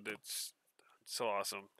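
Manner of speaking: soft, breathy whisper. Real, not acted. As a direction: whisper it in awe. Whispering amazement at letter.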